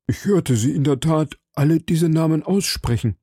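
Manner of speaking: frightened shopkeeper pleading with the cardinal; nervous shopkeeper. A narrator's reading. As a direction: play the anxious little shopkeeper. Timid shopkeeper voice.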